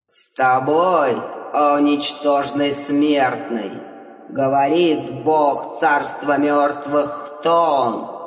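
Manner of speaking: low, slow, booming underworld god; god of the dead. Acted. God answers. (низкий медленный голос бога мёртвых)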